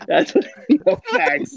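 amused, laughing words; laughing agreement. Real, not acted. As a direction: agree while cracking up. Cracking up at friend's joke.

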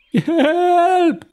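high panicky cry; almost panicked. Acted. Dad crying out in high panic at the phone. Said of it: "næsten helt panisk nu".

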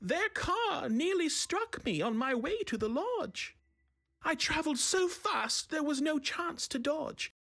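put-on high, light young elf voice; fair youth. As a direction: speak as the young forest elf. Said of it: "the fair youth from the wood".

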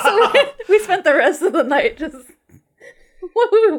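high, loud laughter through words; laughing. Real, not acted. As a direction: talk while cracking up. Recalling silly night.

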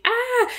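short high mock shriek; mock scream. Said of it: "literally screamed".

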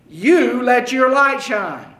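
preacher punching "You" in "You let your light shine"; stress on YOU. Shown as a demonstration. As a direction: hit "You" hard, then let the phrase fall. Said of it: "you is emphatic".

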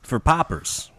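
paupers said with a Chicago vowel; flat Midwestern paupers; Chicago accent. Real, not acted. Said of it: "excuse my Chicago accent".

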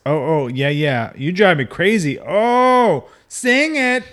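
climactic lyric shouting. Acted. Proving the innuendo in the lyrics, mock-climactic shout. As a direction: build to a loud, high mock-ecstatic cry.

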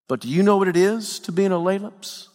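usual speaking voice

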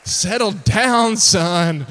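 put-on loud, drawn-out order to a child; exasperated father. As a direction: rein the boy in, loudly and slowly. Father reins in zealous son.

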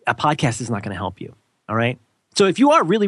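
usual speaking voice

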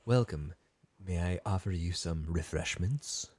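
refined host, soft and low; slow, hushed, mock-genteel welcome. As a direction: play a super refined butler-keeping host. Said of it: "she's, like, super refined and has a".

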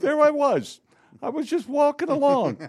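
very high, loud caricature voice; piercing, high-pitched impression; Carol Kane. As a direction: squeal it like a hammed-up sitcom storyteller. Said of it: "hits Carol Kane in the face".

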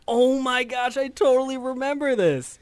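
high, excited remembering; a light mock exclamation; surprised recall. A woman retelling how she suddenly remembered a clip, high and excited.

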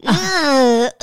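loud, crying-ish stammer of uh uh; flustered stammer. Retold or imitated. Mom caught out by her son's question, flustered stammer. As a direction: caught speechless, half-laughing.

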